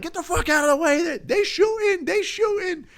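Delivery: high, fast, frantic shout; screaming to get out of the way under gunfire; panicked yell. Pretend. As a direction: scream it while running under fire. Fleeing gunfire, yelling.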